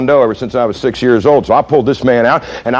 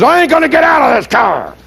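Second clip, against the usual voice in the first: high-pitched bellow of refusal; defiant shout; 12 semitones higher than usual. Retold or imitated. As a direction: roar it like a stubborn driver. Acting out the defiant driver.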